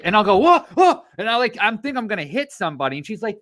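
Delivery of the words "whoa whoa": very high startled driver reaction; startled mock yelp. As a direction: yelp as if about to crash. Imitates startled driver reacting to shriek.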